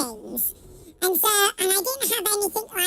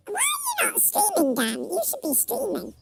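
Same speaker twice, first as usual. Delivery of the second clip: squeaky, very high laughing shout; chipmunk voice; 5 semitones higher than usual. Pretend. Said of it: "my chipmunk voice is operational".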